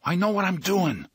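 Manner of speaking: high, insistent comeback; strained, high-pitched insistence; tense retort. A narrator's reading. Eddie answers back under pressure.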